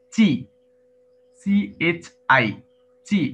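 চি spelled out slowly as সি H I, then চি said clearly; careful pronunciation demo. Shown as a demonstration. Teacher slowly shows students how চি is pronounced. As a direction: say চি letter by letter, then whole. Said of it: "এটা একটু আলাদা উচ্চারণ".

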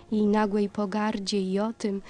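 usual speaking voice

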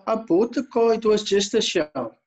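normal talking voice

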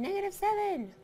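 high-pitched mock yell at a screen; mock scream. Teacher imitates students screaming the answer at the screen, mock scream. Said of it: "screaming at the screen".

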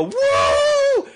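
very high mock whoop; excited cheering. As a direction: imitate fangirls cheering. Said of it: "like, cheering".

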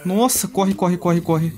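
being chased. Real, not acted. Zombies swarm, running away.

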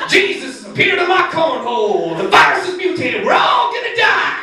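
high shouted panic, building up; hammed-up street prophet yelling; rising mock apocalyptic shout; mock doomsday rant. Imagined doomsday ranting.

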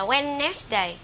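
"Wednesday" misread as three syllables, "wen-nes-day"; mispronunciation. Shown as a demonstration. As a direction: wrongly sound a middle syllable in "Wednesday". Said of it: "đều sai các bạn nha". (đọc sai "Wednesday" thành ba âm tiết)

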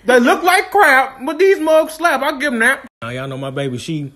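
with food in mouth; talking while chewing. Real, not acted. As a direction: between bites of food. Seen 0: chews and licks lips throughout; bites a sauced fried strip at 3.5.

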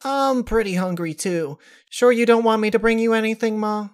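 high, quick eager daughter voice; high youthful voice, quick and earnest; young daughter. Acted. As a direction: fuss helpfully over mom. Daughter voiced offering food.

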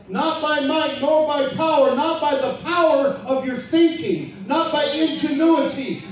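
rising sermon fervor; preaching at full force. Acted. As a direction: thunder it from the pulpit.

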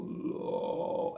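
creaky fry on low, soft and slow; vocal fry low. Shown as a demonstration. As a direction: croak each low down at the bottom. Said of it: "emissão do low". (low em vocal fry, grave e rangido)

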